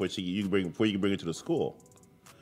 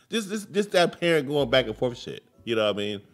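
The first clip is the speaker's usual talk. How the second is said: ordinary delivery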